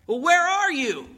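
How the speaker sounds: high, stretched searching call. Acted. Husband searches for drunk stranger, calling out. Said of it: "he calls out".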